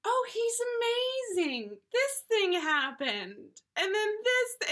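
high, slow gushing voice; a lovestruck high put-on voice; mock infatuated. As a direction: gush about him in a high voice. Imitating infatuated girlfriend.

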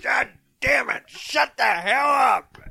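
high mock angry shout; angry dad. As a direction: yell like a fed-up dad. Angry dad answering child.

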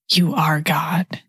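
slow whispered adoration; prayerful whisper. Acted. Preacher praying to Jesus in a hushed whisper.

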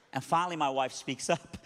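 ordinary talk

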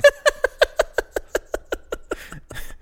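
breathless high giggle; high squeaky laugh. Real, not acted. Laughs at the title card.

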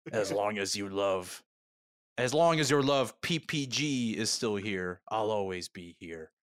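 slow, solemn otherworldly voice; solemn alien. Acted. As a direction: intone it slowly like an ancient being.

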